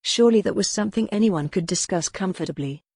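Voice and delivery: ordinary delivery, nothing marked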